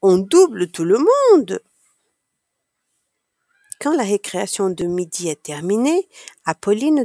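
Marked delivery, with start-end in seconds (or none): 0.0-1.6 put-on loud, swooping childlike thrill; excited cry. A narrator's reading. Child racing her bike past everyone, excited cry. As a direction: cry it out with big pitch swoops. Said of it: "crie Apolline".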